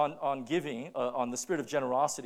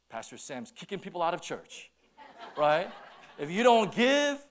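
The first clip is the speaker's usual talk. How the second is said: mock gossip, laughing and louder; hammed-up retelling of the rumour; distorted rumour; 3 semitones higher, 7 dB louder than usual. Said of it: "I didn't say that".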